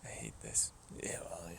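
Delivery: low whisper under the breath; whispered grumble. Real, not acted. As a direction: grumble it softly to yourself.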